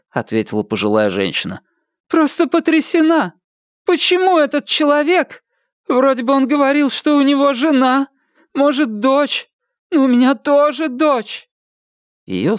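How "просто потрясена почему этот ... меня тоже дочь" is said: put-on very high, distressed old lady voice; high character voice of a rattled old woman; shaken elderly woman. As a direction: lament in bewilderment, pitched high.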